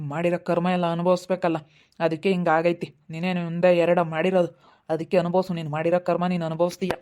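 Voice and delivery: ordinary talk, nothing marked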